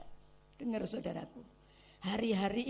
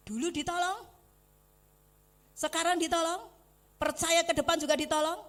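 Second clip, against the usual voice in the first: raised, ringing proclamation, higher and louder; preaching proclamation; 5 semitones higher, 9 dB louder than usual. Real, not acted. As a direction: proclaim it over the crowd.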